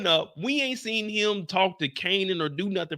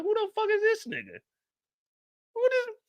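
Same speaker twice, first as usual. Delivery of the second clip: high mock confusion; 13 semitones higher, 4 dB quieter than usual. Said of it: "I'm looking like".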